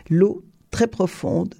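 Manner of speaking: ordinary delivery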